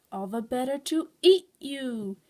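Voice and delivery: slow, loud menacing wolf; drawn-out, forceful villain threat; snapped. A narrator's reading. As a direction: drop the sweet act and snap greedily. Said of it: "he snapped".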